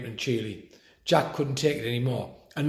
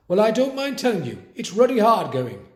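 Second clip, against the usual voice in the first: exclaiming; 7 semitones higher, 5 dB louder than usual. A narrator's reading. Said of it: "exclaimed Grandpa".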